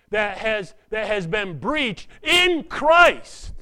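loud fervent sermon climax; preaching at full force. Real, not acted. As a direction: proclaim unity in Christ.

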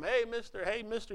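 calling out high into the dark; mild retold yell into the night; mock hollering. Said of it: "hollering for him".

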